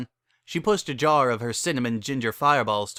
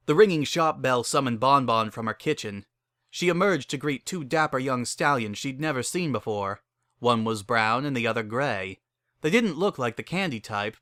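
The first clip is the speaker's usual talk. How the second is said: usual speaking voice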